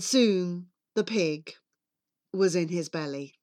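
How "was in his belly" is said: ordinary delivery, nothing marked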